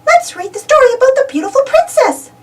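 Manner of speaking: high, bright little mouse voice; sweet high-pitched Minnie; Minnie's voice. Acted. Minnie suggesting a story to friends, high mouse voice. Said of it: "says Minnie".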